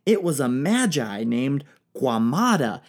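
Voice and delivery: normal talking voice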